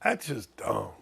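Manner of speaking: a low, understated impression of a scolding dad; quoting his dad. Host imitating his dad telling him off, low voice. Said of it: "To quote my dad".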